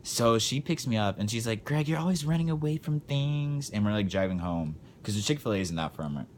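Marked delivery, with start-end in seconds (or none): 1.7-3.7 mom's voice, pitched higher; light high-pitched imitation of a lecturing mom; mocking mom voice. Mom lecturing son in car.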